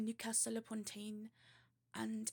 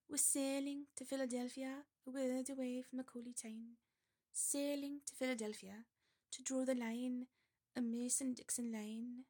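put-on lilting amateur Geordie accent; an attempt at Geordie; 2 semitones higher than usual. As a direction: recite the verse in Geordie. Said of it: "I'll try Geordie one more time".

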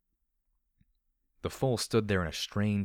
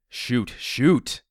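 higher, louder urging, building in alarm; urgent shout; 5 semitones higher, 9 dB louder than usual. A narrator's reading. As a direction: cry SHOOT louder each time. Inner urging escalates to shout.

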